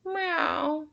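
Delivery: muttered meow. Acted. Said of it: "muttered Gary".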